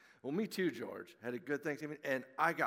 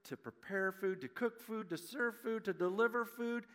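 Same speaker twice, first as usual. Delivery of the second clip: preaching; 6 semitones higher than usual. Real, not acted. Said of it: "preacher's message build".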